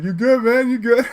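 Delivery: high, loud checking-in shout; calling out. Retold or imitated. Calling out to twirling man.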